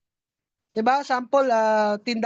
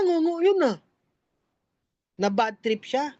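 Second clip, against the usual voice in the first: ordinary talk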